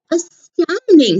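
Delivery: astounded. Acted. Gruffalo amazed.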